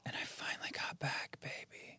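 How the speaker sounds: breathy whispered aside. Real, not acted. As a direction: whisper it like a secret.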